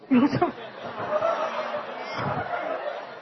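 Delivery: real airy high-pitched laugh; breathy high laugh. Real, not acted. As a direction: laugh out at the punchline. Laughs at the story. (tawa lepas bernada tinggi)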